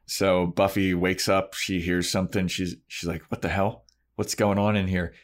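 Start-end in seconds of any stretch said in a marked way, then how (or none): none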